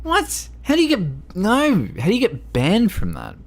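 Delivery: high-pitched, incredulous questioning; real disbelief. Real, not acted. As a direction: pitch shoots up in astonishment. Baffled reaction to someone being banned.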